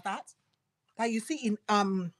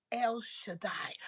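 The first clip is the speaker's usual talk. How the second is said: whisper; 12 dB quieter, slower than usual. Acted. God's name whispered slowly.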